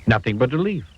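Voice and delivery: ordinary delivery, nothing marked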